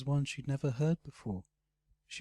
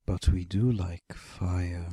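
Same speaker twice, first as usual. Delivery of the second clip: menacing dragon; 5 semitones lower, 6 dB louder, slower than usual. A narrator's reading. Dragon menacing before burning knight.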